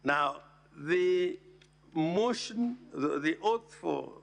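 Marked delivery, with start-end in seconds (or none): none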